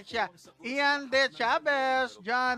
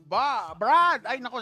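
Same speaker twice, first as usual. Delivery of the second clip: ordinary talk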